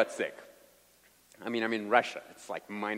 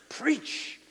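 stretched-out, higher PREACH; preachy emphasis; 12 semitones higher than usual. Retold or imitated. Preacher coaching his translator to add expression, drawn-out emphasis. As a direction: draw out the word with fervor. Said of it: "if I speak louder".

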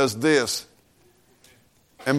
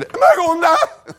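much higher, louder, slow frantic wail through a laugh; hammed-up terror, voice shooting up; panicked shriek; 8 dB louder than usual. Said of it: "If I would have said".

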